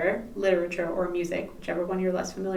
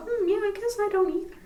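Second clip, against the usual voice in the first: higher, lighter voice, a mock naive musing; naive agreement; 7 semitones higher, 4 dB louder than usual. In Newfoundland, agreeing with partner in mock naive voice. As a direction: play her past self agreeing cluelessly. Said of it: "And I go".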